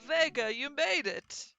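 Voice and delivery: loud, drawn-out welcome; high, hearty host's hello; delighted greeting. Acted. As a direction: greet a guest with big, slow enthusiasm. Host greets arriving guest.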